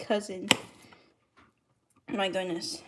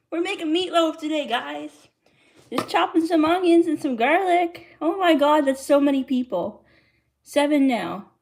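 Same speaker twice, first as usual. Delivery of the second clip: excited announcement; 6 semitones higher, 6 dB louder than usual. Real, not acted. Announcing dish to sudden crowd.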